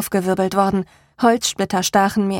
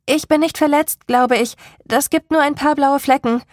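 higher voice, calling reassurance; 6 semitones higher, 3 dB louder than usual. A narrator's reading. Salem reassuring Rain from the hole, calling up. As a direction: call up that all is fine. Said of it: "rief ich".

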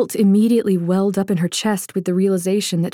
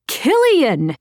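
shouting his name; 7 semitones higher than usual. Acted. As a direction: yell Killian's name after him. Calling louder as he jogs.

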